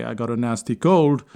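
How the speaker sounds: ordinary talk, nothing marked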